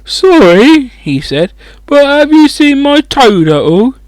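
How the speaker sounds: lightly voiced worried boy asking after his toad; tearful boy. A narrator's reading. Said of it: "He looked tearful".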